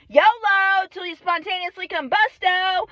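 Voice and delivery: high, jokey battle cry; mock rallying cry. Vlogger cheering on fate, high mock rallying cry. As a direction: call it out like a silly motto.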